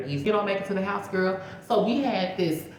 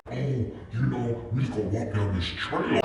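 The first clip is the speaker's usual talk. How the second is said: lower, laid-back guy voice with a chuckle; imitating the boyfriend; 5 semitones lower than usual. Her date calmly suggesting the trail, voiced lower and easy. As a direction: lightly voice a casual guy. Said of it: "He was like".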